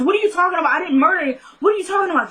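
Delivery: high-pitched acted disbelief; indignant protest. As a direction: imitate someone wrongly accused, protesting. Retold protest at a murder arrest.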